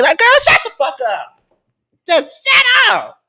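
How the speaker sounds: exasperated outburst. Real, not acted. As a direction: yell at her to shut up.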